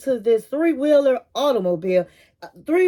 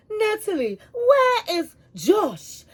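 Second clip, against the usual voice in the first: mother's question pitched much higher and drawn out slowly; imitating an excited mother suddenly asking for her daughter's boyfriend; 9 semitones higher, slower than usual. Mother at the Halloween fest asks Natalie where Josh is, high and drawn out.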